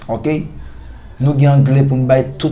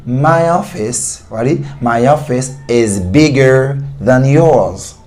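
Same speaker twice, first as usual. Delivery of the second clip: my office is bigger than yours, said very slowly and clearly; slow English model sentence; much slower than usual. Shown as a demonstration. Teacher modelling an English example, slowly. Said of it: "Si on dit".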